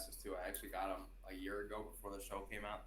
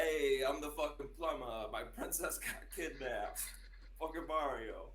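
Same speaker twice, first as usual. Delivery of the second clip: Mario impression; 6 semitones higher, 6 dB louder, slower than usual. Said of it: "I've got a pretty good Mario impression".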